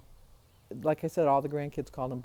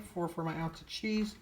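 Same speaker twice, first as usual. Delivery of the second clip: ordinary talk, nothing marked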